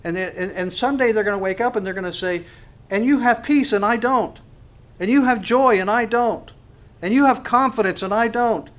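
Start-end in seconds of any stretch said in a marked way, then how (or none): 2.9-8.7 higher, louder longing of someone without peace; envious confession. Retold or imitated. Unbeliever telling the believer what he lacks, higher and pleading. Unbeliever someday admits lack.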